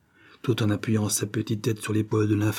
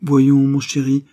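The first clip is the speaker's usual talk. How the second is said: loud, slow, high coaxing call; proclaiming to a scared child, loud and slow; 5 semitones higher, 8 dB louder, slower than usual. A narrator's reading. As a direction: call out over him, sweetly insistent. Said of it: "clamait Diana".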